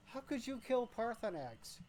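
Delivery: high, loud, aghast question; as if betrayed by a friend; shocked disbelief. Real, not acted. Streamer to viewer who killed Paarthurnax, aghast.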